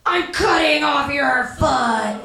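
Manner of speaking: slow, drawn-out shouted threat; child's threat. Retold or imitated. Child points laser pointer at foot, threatening shout. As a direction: play a kid villain, stretching every word. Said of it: "she points at my foot and goes".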